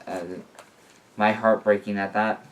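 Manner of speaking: usual speaking voice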